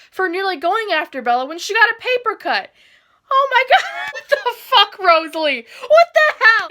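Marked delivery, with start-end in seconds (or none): 3.3-6.7 high, loud disbelief with a laugh; laugh-shout in outrage; shocked laughing outrage. Real, not acted. Shocked by Rosalie's line.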